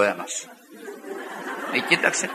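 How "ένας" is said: quiet deadpan reply. Retold or imitated. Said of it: "λέω".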